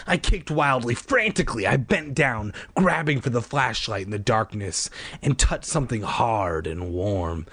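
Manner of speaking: ordinary delivery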